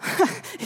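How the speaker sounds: breathy high laugh; airy creature laugh. Acted. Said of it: "Der Feuervogel lachte".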